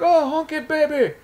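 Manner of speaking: very high-pitched, hammed-up wrestler impression; bad Iron Sheik. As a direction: do a badly imitated Iranian wrestler. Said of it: "Probably doing a bad Iron Sheik".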